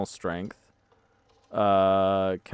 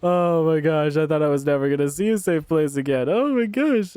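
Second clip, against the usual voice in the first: high, loud relieved gushing; overjoyed, breathless relief; huge relief; 7 semitones higher, 7 dB louder than usual. Real, not acted. Finds safe room after chase.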